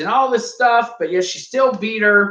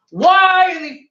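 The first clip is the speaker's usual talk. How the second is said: exasperated outburst; 11 semitones higher, 5 dB louder than usual. Real, not acted. Ranting about the promos.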